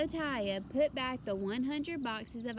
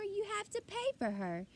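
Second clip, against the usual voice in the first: high insistent cashier voice; 9 semitones higher than usual. A narrator's reading. Cashier tells the father he must pay, insisting. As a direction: insist he pays up.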